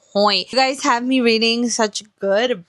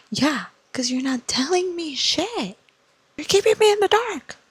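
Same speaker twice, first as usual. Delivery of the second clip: angry outburst; 8 semitones higher than usual. Real, not acted. Fed up with the secretive love interest, angry outburst. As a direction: let the frustration burst out of the hush. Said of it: "I'm mad".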